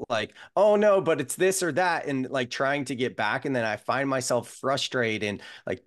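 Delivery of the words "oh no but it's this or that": higher, louder mock panic; mock fretting. Quoting her self-doubt to the host, in higher, louder mock panic. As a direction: throw up your hands at your own doubts.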